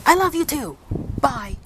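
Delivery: high, clipped "I love you too"; imitating a curt goodbye. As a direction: mimic the man ending the call abruptly. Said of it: "And then he says".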